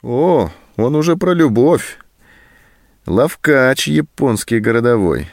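a mocking, drawn-out "Ловкач"; sarcastic jab. A narrator's reading. Sergeant mocks Anton's talk of love, sarcastic. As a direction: sneer at him slowly. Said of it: "съязвил сержант".

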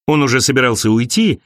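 plain reading voice

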